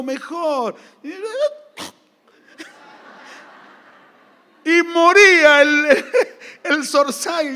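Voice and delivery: laughing hard, words breaking up. Real, not acted. Pastor laughing at the bird story, breaking up. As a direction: laugh through the telling.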